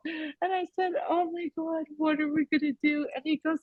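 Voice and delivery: high, soft, spent from laughing; quiet squeaky tail of a laugh; laughing aftermath. Real, not acted. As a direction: catch your breath after cracking up. Still breathless from laughing at story.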